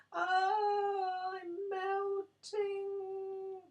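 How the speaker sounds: very high, very slow wail; dying witch wailing very high and slow. A narrator's reading. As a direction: wail it out as she shrinks away. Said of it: "she wailed".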